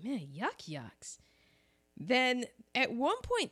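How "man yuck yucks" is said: low, slow mutter of disapproval; muttered groan. Retold or imitated. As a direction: drag out the words as if rolling your eyes. Groaning at corny movie jokes.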